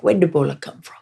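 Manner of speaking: put-on lightly done Liberian pidgin accent question. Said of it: "they have an, a pidgin accent".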